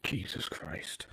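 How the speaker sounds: low breathy whisper of disbelief. Real, not acted. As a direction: mutter it under your breath. Muttered reaction to wrong way.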